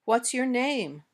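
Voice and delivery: "name" glides down to end the question; falling intonation. Shown as a demonstration. Teacher gives learners an example to repeat, falling intonation. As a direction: let the voice go down on "name". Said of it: "Try these examples".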